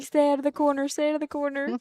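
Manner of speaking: mock pleading. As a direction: plead playfully with a rolling ball. Pleading with the cue ball.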